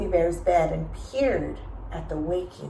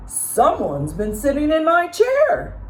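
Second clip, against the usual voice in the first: Papa Bear growling; 10 semitones higher, 6 dB louder than usual. A narrator's reading. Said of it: "Papa Bear growled".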